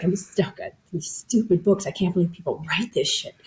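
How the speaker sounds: a skeptic's quiet eye-roll; grumbling inner voice. Retold or imitated. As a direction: mutter it with disdain under your breath. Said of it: "The other half is like".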